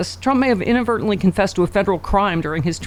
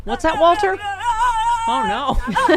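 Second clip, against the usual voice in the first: mock character voice; 10 semitones higher, slower than usual. Mock voice, dog joke.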